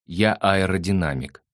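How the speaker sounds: usual speaking voice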